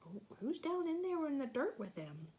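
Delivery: ordinary delivery, nothing marked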